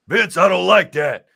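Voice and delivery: playful imitation of an offended wrestler; mock Booker T. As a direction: object in a hammed-up huff. Retelling Booker T's reply.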